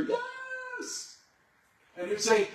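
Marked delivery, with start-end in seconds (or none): 0.0-1.2 high, thin cheer of a crowd; be the whole crowd shouting back. Retold or imitated. Crowd cheering back at the tightrope walker, high. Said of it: "And they would cheer".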